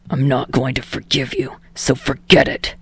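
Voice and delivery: snarled. Acted. Bakugo answering Deku's call, low snarl.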